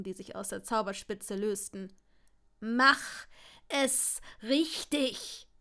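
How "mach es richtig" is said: slow, forced-out demand; uncontrolled shout. A narrator's reading. Draco ordering Longbottom to cast properly, shouting unrestrained. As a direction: hammer out each word slowly, starting loud. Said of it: "schrie Draco unbeherrscht".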